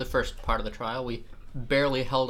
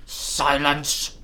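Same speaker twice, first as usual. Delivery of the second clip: loud attempt at a stern order; failed commanding shout; 6 dB louder than usual. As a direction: call it out firmly and loudly. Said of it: "No, that's not right either".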